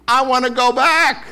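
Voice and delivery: high, loud whining cry; mock whine. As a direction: wail it like a homesick slave. Mimicking regretful Israelites.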